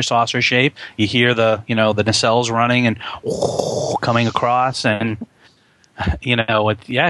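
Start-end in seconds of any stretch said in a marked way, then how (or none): 3.0-4.0 breathy whoosh of a passing starship; imitated engine whoosh. Describing the ship coming across, imitated whoosh. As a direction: blow a long rushing whoosh.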